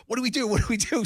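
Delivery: imitating an excited boy; excited kid. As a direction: voice the little son in a thrilled panic. Son panicking after pineapple prank.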